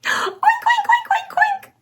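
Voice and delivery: very high, giggly oinking; high laughing piggy oinks; piglet oinks. Shown as a demonstration. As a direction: squeak like a little piglet. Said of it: "I'll do it with you".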